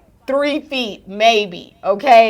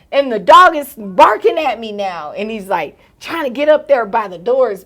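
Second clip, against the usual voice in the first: normal talking voice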